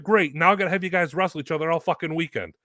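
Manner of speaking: exasperated boss. Retold or imitated. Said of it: "He was like".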